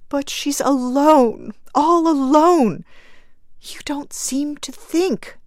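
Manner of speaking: high, tearful, choking voice; a grieving mother, choking up; choked with tears. Acted. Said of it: "choked the woman".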